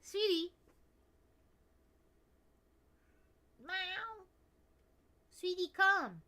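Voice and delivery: high fond scolding. Real, not acted. Owner fondly scolds her noisy cat, high pet voice.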